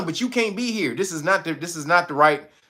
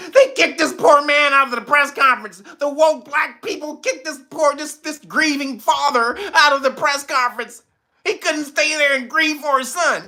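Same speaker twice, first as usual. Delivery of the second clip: mocking pundits; 8 semitones higher than usual. Vlogger mimics online pundits with light mock outrage. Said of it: "they jumped on it and was like".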